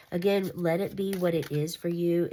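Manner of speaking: normal talking voice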